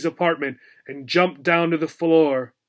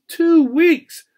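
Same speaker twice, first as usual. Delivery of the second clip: high, loud despairing wail; 12 semitones higher, 6 dB louder than usual. A narrator's reading. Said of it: "wailed Obie".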